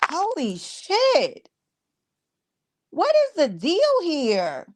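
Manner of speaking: high, slow stunned gasp of words; astonished. Real, not acted. Reacting to rival's antics, slow high astonishment. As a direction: draw it out in disbelief.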